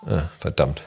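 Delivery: ordinary talk, nothing marked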